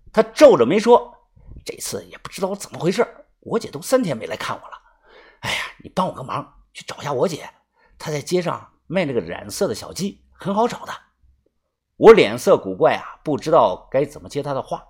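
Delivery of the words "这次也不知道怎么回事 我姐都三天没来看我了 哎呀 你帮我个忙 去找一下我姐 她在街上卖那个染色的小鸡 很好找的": hushed, hurried request for a favour; impatient young prisoner, pleading. A narrator's reading. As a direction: softly press him for help, quickly. Voices the locked-up man asking a favour.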